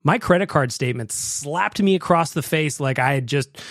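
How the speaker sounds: ordinary talk, nothing marked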